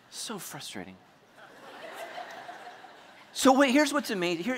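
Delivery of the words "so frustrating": very soft, deflated aside; frustrated mutter. Retold or imitated. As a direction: drop to a grumble under your breath. Deflated aside after outburst.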